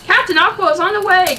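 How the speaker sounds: loud high heroic call; loud eager announcement; shouted. Acted. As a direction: shout it as you run. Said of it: "Captain America shouted".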